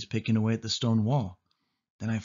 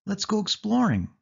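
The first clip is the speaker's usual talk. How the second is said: higher, bright-eyed sudden suggestion; sudden eager urge; 10 semitones higher than usual. A narrator's reading. Sari springs the idea on her cousin, eager. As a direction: blurt it out, keen for adventure. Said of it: "Sari said suddenly".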